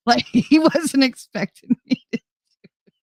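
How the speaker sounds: normal talking voice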